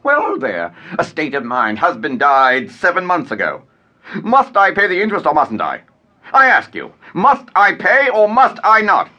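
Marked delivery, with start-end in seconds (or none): 4.2-9.1 angry demand. Acted. Heated quarrel, shouting demand.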